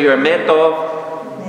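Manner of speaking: slowly spelled-out "You are me tov"; slow model line. Shown as a demonstration. Preacher modeling the phrase for the congregation, slow. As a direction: give the line slowly for them to repeat. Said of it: "turn to the person next to you".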